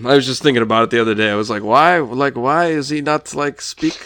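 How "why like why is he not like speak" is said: high, incredulous questioning; comically baffled, voice climbing; mock bafflement. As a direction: act out exaggerated disbelief, pitched up. Acting out his own baffled question.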